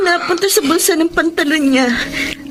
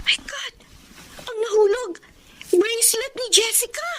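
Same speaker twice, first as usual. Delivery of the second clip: shocked discovery; 6 semitones higher, 8 dB quieter than usual. Acted. Discovering the missing girl's bracelet.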